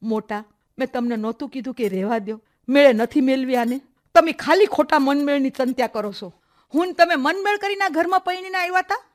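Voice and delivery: loud, rising reproach; stern rebuke. Acted. Aunt rebukes elder sister-in-law, loud and rising. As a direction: scold your sister-in-law sharply.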